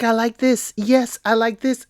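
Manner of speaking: ordinary talk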